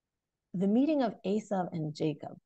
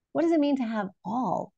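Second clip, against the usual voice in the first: ordinary talk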